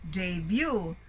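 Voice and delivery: "debut" said day-BYOO; correct pronunciation. Shown as a demonstration. Teacher modelling the word, "debut" as day-BYOO. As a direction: say "debut" with an ay, then stressed byoo. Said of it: "it should be debut".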